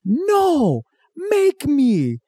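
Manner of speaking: put-on high drunken taunt. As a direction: dare them to try. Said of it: "they're like".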